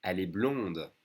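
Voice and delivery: blonde closed with an audible D; pronounced D. Shown as a demonstration. Teacher modelling the form for a girl, pronounced D. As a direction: sound the D at the end of blonde. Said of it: "you pronounce the D".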